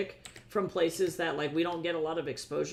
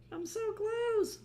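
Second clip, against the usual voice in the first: high excited squeal; slower than usual. Real, not acted. Nearly done with drawing.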